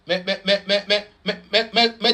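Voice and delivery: ordinary talk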